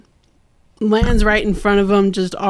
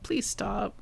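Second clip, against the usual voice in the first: very soft imitated pleading; gentle plea; 13 dB quieter than usual. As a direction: plead quietly, close in a hug. Katara pleads while hugging Aang.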